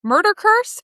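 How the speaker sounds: ordinary delivery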